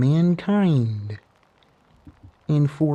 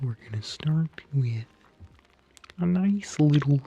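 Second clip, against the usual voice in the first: soft whisper; 3 dB quieter than usual. Acted. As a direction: hush it like lulling him to sleep. ASMR massage of Bigfoot.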